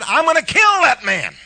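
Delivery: the preacher voicing Jezebel's rage in a shout; furious shout. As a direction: shout it like an enraged queen. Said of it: "And Jezebel said".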